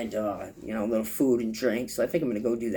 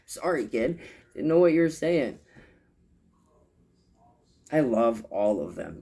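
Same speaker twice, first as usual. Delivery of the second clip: ordinary talk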